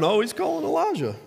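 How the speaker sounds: mocking laugh. Bystanders jeering at the dying man, mocking high. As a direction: jeer it like a bystander. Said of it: "But they're laughing".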